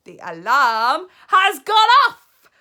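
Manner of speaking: high, very loud slow screech; triumphant witch screech. Acted. Grand High Witch screeching at the audience. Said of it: "screeched the Grand High Witch".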